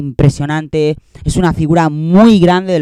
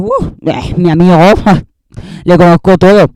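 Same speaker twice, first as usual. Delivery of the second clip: mock superfan. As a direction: play a gushing, laughing fan who thinks they know the star. Mocking fan who thinks knows influencer.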